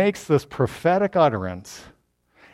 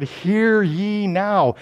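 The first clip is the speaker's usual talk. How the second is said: slow, higher herald's summons; stately, raised-pitch proclamation; official proclamation; 4 semitones higher, much slower than usual. Acted. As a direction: draw it out as an official call. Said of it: "he's got an official now".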